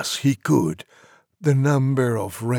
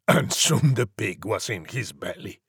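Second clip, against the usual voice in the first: usual speaking voice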